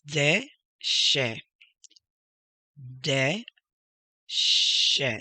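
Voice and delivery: DE and SHE slowly, split apart; slow, separated de ... she for learners; syllable by syllable. Shown as a demonstration. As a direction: sound out de, pause, she.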